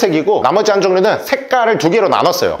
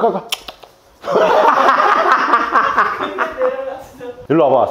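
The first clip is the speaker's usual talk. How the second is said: ordinary talk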